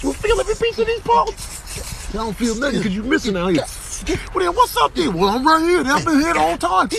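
high goofy animal voice; squeaky cartoon animal trash talk. Narrator voices one animal taunting another, high and silly. Voicing wrestling animals in a clip.

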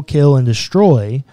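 ordinary talk

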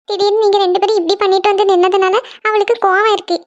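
ordinary talk, nothing marked

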